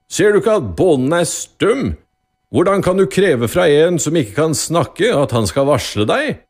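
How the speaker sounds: loud, high scolding from a judge; irritated. A narrator's reading. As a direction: tell them off in exasperation. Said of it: "sa irritert".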